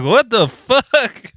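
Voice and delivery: high, slow, stretched mock shock; drawn-out disbelief. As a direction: drag it out incredulously. Retold disbelief.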